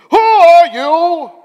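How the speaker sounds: high, very loud, drawn-out challenge; hammed-up interrogation yell; demanding shout. A narrator's reading. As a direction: bellow the question slowly. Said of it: "they demanded".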